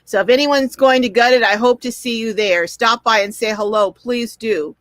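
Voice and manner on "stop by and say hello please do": normal talking voice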